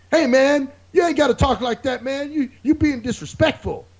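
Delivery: high-pitched imitation of an indignant scolder; hammed-up righteous objection; mock social justice warrior. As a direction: scold him in a put-on high voice. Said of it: "this guy got all social justice warrior on me".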